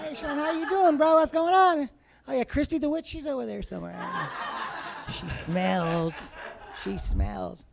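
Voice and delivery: high, goofy bro dog voice; dog's voice. Pretend. Dog Bojack chatting to his owner, high goofy bro. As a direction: give the dog a dumb friendly bro voice. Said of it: "dog voice".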